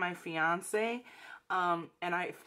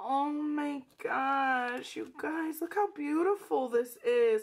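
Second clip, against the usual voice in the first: real awe; 6 semitones higher than usual. Real, not acted. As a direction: gush to viewers, overcome with delight. Gushing over gift to viewers.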